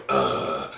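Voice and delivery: low, loud mock groan of annoyance; exaggerated disgusted grunt; annoyed groan. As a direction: imitate a fed-up "ugh". Imitating annoyed partner's noise.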